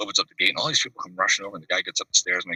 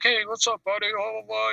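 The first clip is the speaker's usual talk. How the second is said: much higher, mock rock-star greeting; imitating a rock singer. Rock star walks into the house, greets him in a high mock voice. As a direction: pitch the greeting way up like a famous singer.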